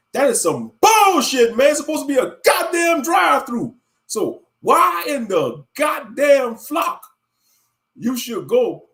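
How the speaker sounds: shouting, high and slow at the end; furious rant about the drive-thru wait; angry rant. Real, not acted. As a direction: vent the anger loud at the fast food place.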